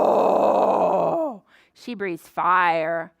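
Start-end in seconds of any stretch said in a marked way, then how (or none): 0.0-1.4 low, loud playful roar; angry dragon roar. Pretend. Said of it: "When Henrietta's mad, what does she do?".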